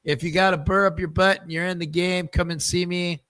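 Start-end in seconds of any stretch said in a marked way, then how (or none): none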